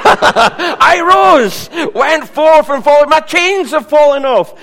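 high, loud jubilant cry; shouting the hymn's triumph; joyful outburst. Acted. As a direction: burst out like a freed prisoner. Hymn climax shouted in joy.

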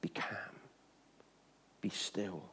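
calm the storm in a hush; near-whisper be still; hushed command. Acted. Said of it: "Jesus stands up and says".

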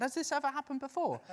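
high, fast worried question; quick anxious check; hurried concerned. Retold or imitated. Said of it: "I said".

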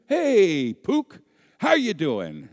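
loud, high, drawn-out happy greeting; jovial dad. Retold or imitated. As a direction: greet your kid with hammed-up fatherly cheer. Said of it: "my dad answers the phone, his jovial".

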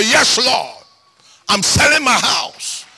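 ordinary delivery

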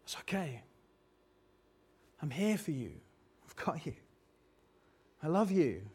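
very soft, tender, long pauses; hushed parent murmuring reassurance; comforting whisper. Acted. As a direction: soothe a hurt little boy. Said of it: "I pick him up and I whisper".

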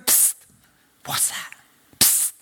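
hissing whisper of a snake. Acted. Snake calls Eve secretly.

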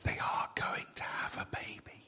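hushed secret whisper. Real, not acted. Preacher telling the congregation, in a whisper. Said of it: "I don't know why I'm whispering".

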